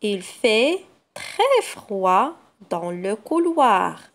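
patient slow reread. Shown as a demonstration. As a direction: reread the sentence word by word for the children. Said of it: "Je refais la lecture de la phrase".

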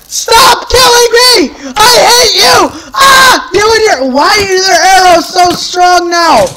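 high-pitched screaming outburst, nearly crying; hysterical rage. Real, not acted. Player killed by strong arrows, hysterical rage. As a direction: shriek in desperate fury at your attacker.

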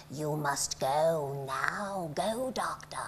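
slow dramatic whisper. Retold or imitated. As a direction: breathe it as a mysterious farewell. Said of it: "and I said".

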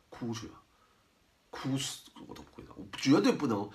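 ordinary talk

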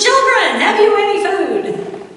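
high, loud call across the water; hollering out. Retold or imitated. Jesus hollers from shore to the fishermen, high loud call. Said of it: "He hollers out to them".

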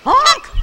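very loud, high honk; loud high-pitched squawking honk; goose honk. A narrator's reading. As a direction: blast out an outraged honk. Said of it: "balked Matilda".

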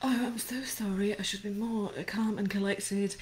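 hushed, apologetic voice; mock professional whisper. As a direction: play the prim professional, softly. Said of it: "Maybe I should be a little bit more professional".

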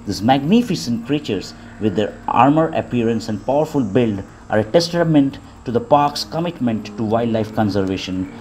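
calm, even wildlife narration; nature documentary. Acted.

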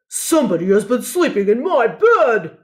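a big papa bear roaring at his bowl; booming papa bear voice with a mock roar. A narrator's reading. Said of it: "roared Papa Bear".

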